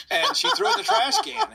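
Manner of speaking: said through high laughter; can't stop laughing while telling it. Real, not acted. Teller laughing as he recounts the trash can.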